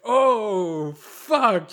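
high, loud startled oath; mock shocked curse. Viewer hearing the goat's voice, shocked curse. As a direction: blurt it out in horror. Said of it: "it's just like".

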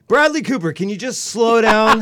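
high, loud comic appeal; exasperated mock protest; mock plea. Mock plea to the absent actor.

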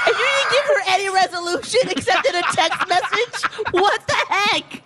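high, incredulous protest through laughter; laughing mock outrage. Real, not acted. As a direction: scold the teller while cracking up. Listener's mock outrage amid laughter.

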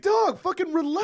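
high, loud buddy protest; mock exasperated plea. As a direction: play begging a wild friend to chill. Acted pleading with drunk-like kid.

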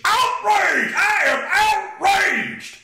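high, drawn-out hammed-up shout; theatrical shrieked indignation; mock outrage. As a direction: bellow it like a scandalized protester. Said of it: "there'd be outrage".

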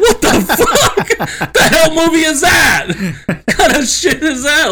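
high, loud, laughing disbelief; shocked outburst. Retold or imitated. Shocked reaction to mom's spoiler.

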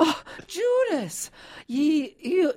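put-on high, drawn-out old-timey Cornish accent; Demelza. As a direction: exclaim slowly in a put-on Cornwall voice. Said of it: "the character Demelza on Poldark always says".

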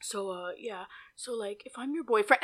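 soft, low, hesitant impression; hushed, halting mock date voice; imitated mumbling. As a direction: imitate him trailing along under his breath. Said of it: "he starts talking more like".

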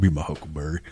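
a hint of a Tombstone gunslinger; Val Kilmer drawl. Quoting Tombstone to his friend, lightly voiced low cowboy. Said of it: "sound like Val Kilmer in Tombstone".

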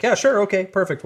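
forced cheer. Retold or imitated. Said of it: "You're like".

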